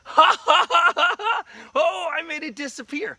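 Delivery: high, loud, hammed-up cackle; gloating laughter. Daddy gloating over the vanished glass, laughing. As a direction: crow through laughter like a prankster.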